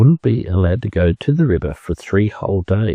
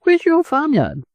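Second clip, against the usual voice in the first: eager. Acted. Duckling eager question.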